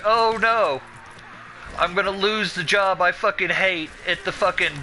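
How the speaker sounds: high, exaggerated "oh no"; sarcastic mock dismay. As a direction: feign horror at an empty threat. Sarcastic reply to job threat.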